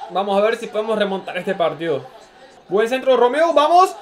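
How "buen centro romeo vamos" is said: excited cheering. Real, not acted. Cheering an attack live.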